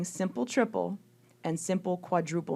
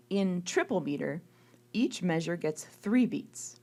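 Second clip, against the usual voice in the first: usual speaking voice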